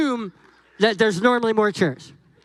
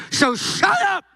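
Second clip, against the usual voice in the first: mock exasperated; 8 semitones higher, slower than usual. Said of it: "And Moses is saying".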